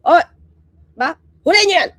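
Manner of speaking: loud barking orders, Oy! Oy!; mock shouting. Mocking a leader yelling at officials, loud shout. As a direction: yell at them like an angry boss. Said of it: "hindi mo kailangan sumigaw".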